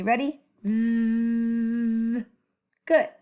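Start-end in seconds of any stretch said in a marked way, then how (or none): 0.6-2.3 chainsaw buzz with tongue between teeth; voiced th demo. Shown as a demonstration. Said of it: "Make the sound with me".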